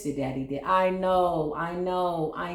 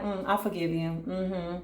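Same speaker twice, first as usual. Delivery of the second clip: a grudging, hollow "I forgive you", softer; insincere forgiveness; 4 dB quieter than usual. Shown as a demonstration. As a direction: say it without meaning it, waiting for karma. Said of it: "the way I said those two things".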